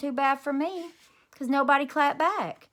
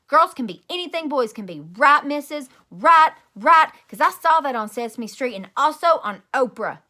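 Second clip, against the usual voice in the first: pleading shout; 3 semitones higher, 10 dB louder than usual. A narrator's reading. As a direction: beg the teacher to back her up. Girl appeals loudly to teacher.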